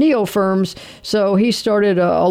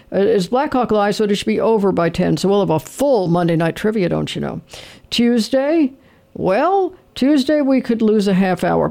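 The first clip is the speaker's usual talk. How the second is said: ordinary talk, nothing marked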